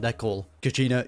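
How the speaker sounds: ordinary talk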